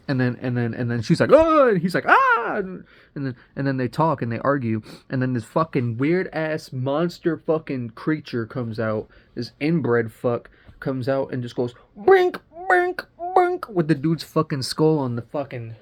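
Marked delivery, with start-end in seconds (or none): 1.3-1.7 high, loud mock scream; short startled mock shriek; imitated scream. She meets the crawling man in the tunnel, mock scream. 2.1-2.6 very high, loud mock shriek; imitated scream back. Crawling man screams back at her, mock scream. 12.0-13.7 high, loud squeaky impact noises; repeated squealed cartoon bangs; imitated smashing noise. Creature bashes the dude's skull on wall, imitated noise.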